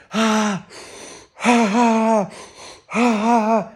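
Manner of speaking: loud, high, forced ha ha bursts; forced laughter. Shown as a demonstration. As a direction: push the laugh out hard and loud. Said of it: "you might just start going".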